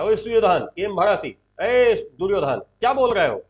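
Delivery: loud acted rebuke in short bursts; ringing, challenging reprimand; Krishna, sharp scolding. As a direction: shout him down by name. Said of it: "Krishna डांट दिए दुर्योधन को".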